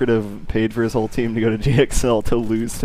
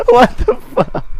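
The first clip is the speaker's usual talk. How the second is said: much higher, louder cry through laughter; laughing what the fuck; laughing disbelief; 5 dB louder than usual. Real, not acted. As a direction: shriek it while cracking up. Friend shocked, laughing.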